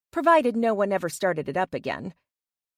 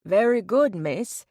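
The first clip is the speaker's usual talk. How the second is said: louder, hammed-up British butler; imitating British butler; 4 dB louder than usual. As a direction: mimic a British manservant with gusto. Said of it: "bring me tea and crumpets and say".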